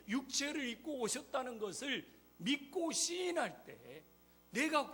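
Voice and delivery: high, loud pulpit proclamation; full-force sermon, key words hit hard; forceful preaching. Real, not acted. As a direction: proclaim it to the congregation with mounting insistence.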